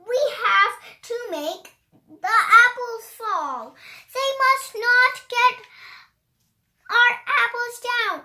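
high, lively storybook read-aloud; reading to children. Acted. A child reading a rhyming book aloud, high and animated. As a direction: bounce through the rhymes like a picture book.

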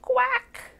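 short high-pitched quack; duck quack. A narrator's reading. Storyteller voicing the horse's surprise sound, high quack. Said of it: "out came a".

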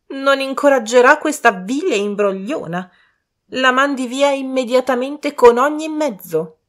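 high, loud commanding lady; crying out. A narrator's reading. Lady demanding the impostor be sent away, high loud cry. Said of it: "gridò Lady Ingram".

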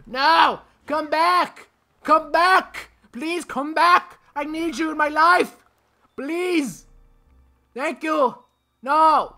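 very high, loud pleading yell; desperate screaming. Real, not acted. Gamer begging it to come back, desperate screaming. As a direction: wail for it to return, over the top. Calling the game object back.

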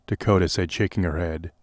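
plain reading voice

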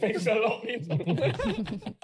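slow thanks through laughter; laughing thanks. Real, not acted. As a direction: try for sincere but crack up.

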